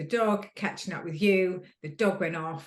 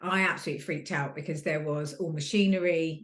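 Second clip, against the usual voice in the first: usual speaking voice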